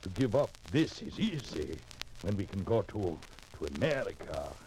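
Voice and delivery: put-on hopeful man in a European immigrant accent. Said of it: "With that accent?".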